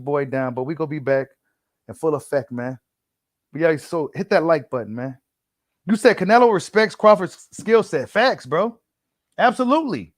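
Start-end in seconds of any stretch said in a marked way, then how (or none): 5.9-10.1 fired up. Real, not acted. Fired-up reply to viewer comment.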